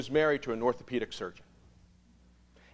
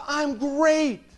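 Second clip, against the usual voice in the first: upbeat reply; 13 semitones higher, 8 dB louder than usual. Retold or imitated.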